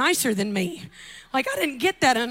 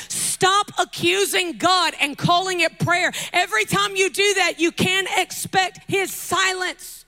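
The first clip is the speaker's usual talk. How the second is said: firm preaching; 5 semitones higher, 4 dB louder, slower than usual. Acted. Preaching build, higher and slow.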